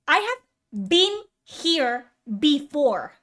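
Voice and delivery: slow, word-by-word insistence; slow, emphatic. Real, not acted. Exasperated streamer repeating himself to viewers, slow and emphatic. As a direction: space out "I have been here before", pressing each word.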